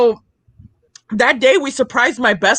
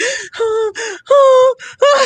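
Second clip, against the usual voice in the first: repeated high, sharp gasps; mock overwhelmed panting; hyperventilating gasps; 10 semitones higher than usual. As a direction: act out hyperventilating with emotion.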